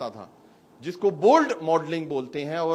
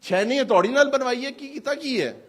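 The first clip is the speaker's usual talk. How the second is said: mocking question; 6 semitones higher, 4 dB louder than usual. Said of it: "میں تو کئی دفعہ پوچھتا ہوں".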